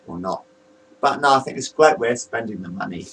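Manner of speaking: ordinary talk, nothing marked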